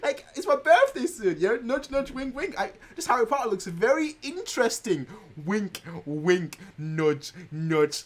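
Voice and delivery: high, excited hint with loaded pauses; nudge-nudge hinting, laid on thick; hinting for a present. Retold or imitated. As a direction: drop an unsubtle birthday hint. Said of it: "I kept telling her".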